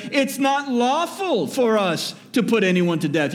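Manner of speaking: lightly voiced insistent accusers. Accusers insisting to Pilate they cannot execute, lightly voiced.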